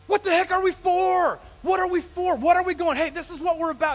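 loud, high preaching cry; impassioned challenge. Real, not acted. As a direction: demand it of the congregation at full voice.

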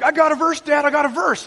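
high, loud imitation of excited children; eager kids. Kids rushing to tell Dad their verse, eager imitation. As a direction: pipe up like a kid bursting to tell. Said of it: "So all my kids are like".

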